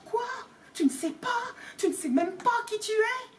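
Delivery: climbing to high disbelief; rising incredulous questions; astonished. Acted. Hagrid astonished. (Hagrid stupéfait, voix qui monte)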